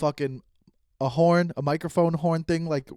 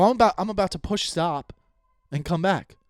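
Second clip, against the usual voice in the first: usual speaking voice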